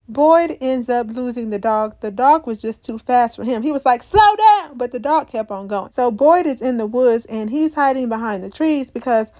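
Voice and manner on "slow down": higher, louder mock yell; calling out. Boyd calling after the runaway dog, mock yell. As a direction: imitate a man yelling after someone.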